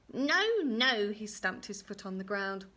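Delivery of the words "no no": loud, high, stamping no-no; cross refusal. Acted. Little man stamps at wrong guess, angrily. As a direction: throw a little tantrum on it.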